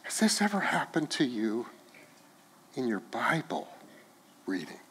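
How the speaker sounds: quiet, searching question with long pauses; hushed repeat. Real, not acted. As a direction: let the question sink in, fading almost away. Same question repeated, hushed and slow.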